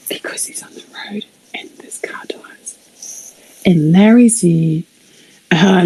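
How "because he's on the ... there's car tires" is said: breathy near-silent whisper, slowed down; hushed whisper. Real, not acted. Whispering while watching the bug near the car tires. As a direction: whisper it as if afraid to look. Worried about the cockroach.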